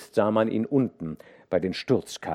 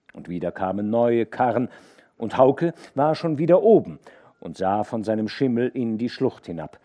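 ordinary delivery

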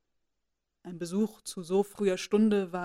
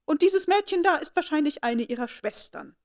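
haughty lady; 7 semitones higher, 4 dB louder than usual. A narrator's reading. Lady Catherine voiced.

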